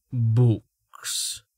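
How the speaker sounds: a dismissive scoffed word. A narrator's reading. Reason scoffing at Stavo's books. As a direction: sneer at the very idea. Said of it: "Reason said with a scoff".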